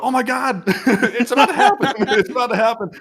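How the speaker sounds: very high, laughing through the alarm; laughing mock panic. Cubans realizing war is coming, high laughing mock panic. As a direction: panic but crack up while saying it.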